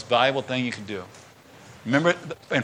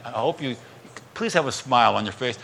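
ordinary talk, nothing marked

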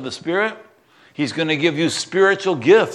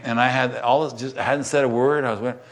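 ordinary talk, nothing marked

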